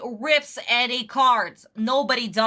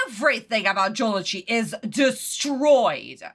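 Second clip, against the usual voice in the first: normal talking voice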